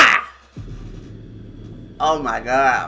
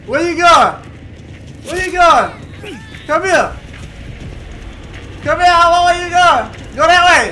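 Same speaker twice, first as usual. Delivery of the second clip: calling out; 9 semitones higher than usual. Real, not acted. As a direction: shout after someone running off. Calling after fleeing victim in game.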